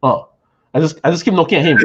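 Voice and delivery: usual speaking voice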